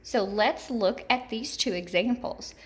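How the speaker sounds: normal talking voice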